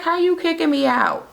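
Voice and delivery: high-pitched mock indignation; indignant protest. Woman protesting to the man firing her, mock indignant. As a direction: imitate an outraged, high-voiced woman.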